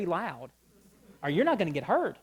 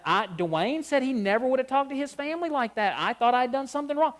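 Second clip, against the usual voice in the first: louder, higher, anxious rush; retold worry; 6 semitones higher, 5 dB louder than usual. Son telling his mom what his friend said, louder and worried. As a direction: spill it out like you fear you did wrong.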